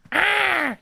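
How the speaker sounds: high squeaky Toad yelp; toad shriek. Acted. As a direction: cry out as a tiny Toad. Toad voice cry continues.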